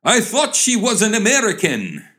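high-pitched flustered excuse; astonished, high protest; startled exclamation. Acted. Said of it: "exclaimed the man, jumping up".

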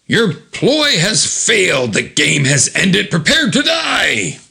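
high, declaimed villain threat; Omega Man. Retold or imitated. Villain corners the heroes, hammy menacing proclamation. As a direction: proclaim doom slowly, rising to a drawn-out shout. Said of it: "there is Omega Man standing there".

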